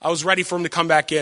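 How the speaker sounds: ordinary talk, nothing marked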